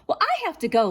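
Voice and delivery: put-on high, loud hurried girl's voice. A narrator's reading. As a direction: rush it out like a nervous girl. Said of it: "said quickly".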